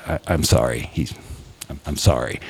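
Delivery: low, halting apology; acting out breaking bad news; hesitant and grave, pitched well down; somber priest.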